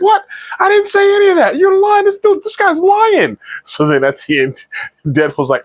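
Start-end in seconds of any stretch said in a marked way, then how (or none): 0.0-3.4 cartoonish high-voiced outrage; mock indignant denial. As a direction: imitate a guy frantically denying he ever said it. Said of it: "Storm was like".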